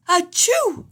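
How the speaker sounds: a big high sneeze cutting the sentence; bigger sneeze. A narrator's reading. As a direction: explode into the sneeze. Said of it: "sneezing an even bigger sneeze".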